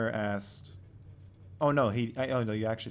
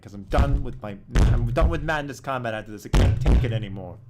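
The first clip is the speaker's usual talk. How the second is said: much louder, exasperated declaration; fed-up outburst; 13 dB louder than usual. Real, not acted. Creator swearing off Madness Combat, loud fed-up outburst. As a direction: burst out loudly, utterly done with it.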